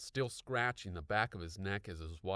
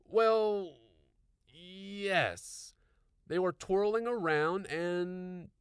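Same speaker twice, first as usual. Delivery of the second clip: confused; 11 semitones higher, 6 dB louder, much slower than usual. A narrator's reading. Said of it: "looking terribly confused".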